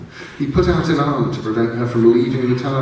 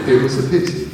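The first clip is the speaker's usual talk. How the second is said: ordinary delivery, nothing marked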